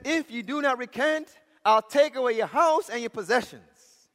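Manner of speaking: acted judge, high and forceful; loud threat. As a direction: threaten him as an angry official.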